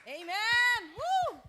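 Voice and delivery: loud, high Amen and whoop; cheering shout. Real, not acted. Co-host cheering the congregation, loud high whoop. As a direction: shout it out to fire up the room.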